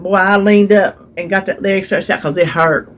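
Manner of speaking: normal talking voice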